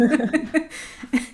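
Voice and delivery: short real laugh; amused laugh. Real, not acted. Host laughs.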